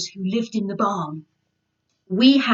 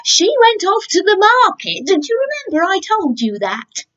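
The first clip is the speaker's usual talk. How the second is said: a hint of gentle scolding; 10 semitones higher, 4 dB louder than usual. Character answering piglet.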